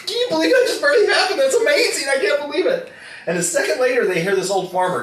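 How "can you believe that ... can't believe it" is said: high, loud, hammed-up amazement; amazed excitement. Farmers who just saw a goat dive into a hole, amazed. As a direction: squeal it in mock astonishment.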